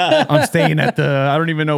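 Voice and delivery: laughing. Real, not acted. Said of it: "laughing while telling".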